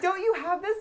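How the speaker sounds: high, loud retold freak-out; hammed-up high, loud incredulity; mock frantic disbelief. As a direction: imitate yourself frantically demanding an answer. Retold frantic question to husband.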